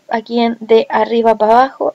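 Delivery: ordinary talk, nothing marked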